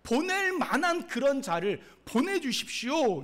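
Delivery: loud, high, drawn-out plea; Moses's excuse. Acted. Preacher as Moses begging God to send another, loud and high. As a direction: beg off with exaggerated insistence. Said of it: "그냥 하는 얘기가".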